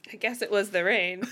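normal talking voice

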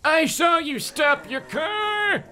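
squeaky big man voice; old man voice, very high; high-pitched voice. Acted. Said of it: "He had a high-pitched voice".

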